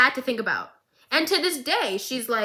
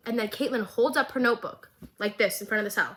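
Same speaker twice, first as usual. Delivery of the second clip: ordinary talk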